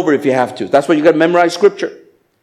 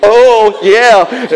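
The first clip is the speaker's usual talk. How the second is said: loud high mock bro greeting; loud, high mock "Hey, oh, yeah" between guys; hyped guy greeting; 8 semitones higher, 7 dB louder, much slower than usual. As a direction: hype it up like a buddy. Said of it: "that's the guy thing".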